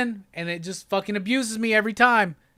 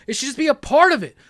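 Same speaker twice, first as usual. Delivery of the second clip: usual speaking voice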